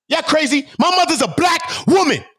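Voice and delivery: high, forceful outburst; real indignation. Real, not acted. Outraged defence of his mother.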